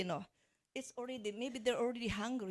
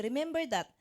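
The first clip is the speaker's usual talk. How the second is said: ordinary delivery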